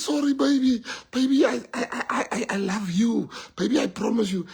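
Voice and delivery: high, stammering mock begging; pleading husband. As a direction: plead desperately as a caught cheater. Acted husband begging wife.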